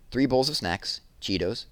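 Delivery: ordinary delivery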